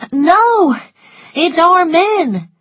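high, loud cry of alarm; urgent, high-pitched alarm; panicked servant Prissy. Acted.

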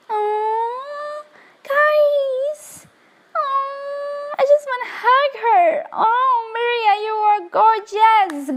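teary. Real, not acted. Overwhelmed by the newborn.

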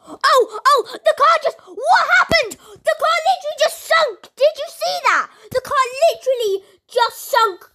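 can't believe what just happened; excited disbelief. Real, not acted. Gamer telling viewers the car just sank: high, loud, amazed.

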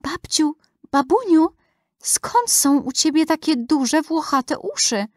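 put-on high little girl, opening in a hushed voice. A narrator's reading. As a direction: ask hesitantly, high, as a wary granddaughter. Girl questions disguised wolf. (wysoki, nieśmiały głos wnuczki)

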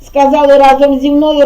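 normal talking voice

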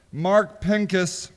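normal talking voice

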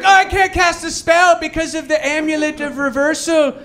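high, strained mock-wizard whine; whining wizard. Comic mocking wizards stuck in a magic battle, high mock whine. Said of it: "where they're like".

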